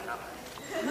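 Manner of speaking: ordinary talk, nothing marked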